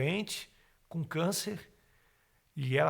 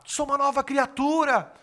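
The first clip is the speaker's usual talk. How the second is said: high, loud joyful yell; retold ecstatic outburst, full voice; ecstatic shouting; 12 semitones higher, 10 dB louder than usual. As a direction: shout it out in rapture in the middle of the church. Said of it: "comecei a gritar".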